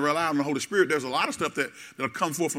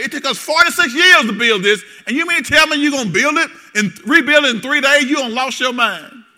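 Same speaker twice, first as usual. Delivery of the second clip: loud, high mocking disbelief; scoffing crowd; 7 semitones higher, 7 dB louder than usual. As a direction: scoff at the preposterous claim. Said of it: "what did they say".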